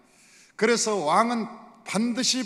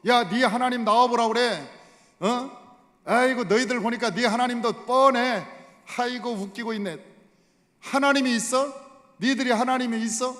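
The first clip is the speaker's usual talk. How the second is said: raised, scornful taunting; mocking insult; 3 semitones higher, 4 dB louder than usual. Goliath ridiculing Israel's God, loud mockery. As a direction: scoff and challenge them. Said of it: "하나님을 모욕하고 하나님을 조롱하고".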